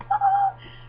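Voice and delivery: high, gleeful burst of laughter; excited laugh. Real, not acted. Laughing about her character getting into the tournament. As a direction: laugh out the real delight. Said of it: "I was so excited".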